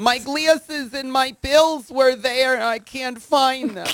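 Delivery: put-on high, slow old-lady whine; fretful old lady looking for her things. Old person can't find pills.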